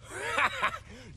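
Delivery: a sharp high laugh of scorn; high scoffing laugh. Acted. Baron scoffing at the witcher's request for guarantees.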